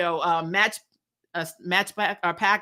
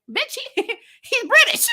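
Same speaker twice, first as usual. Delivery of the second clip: high, laughing mock outburst; laughing retort; 13 semitones higher, 4 dB louder than usual. Talking back at the character on screen, high laughing outburst. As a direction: squeal it out, cracking up mid-line. Her own retort to the show.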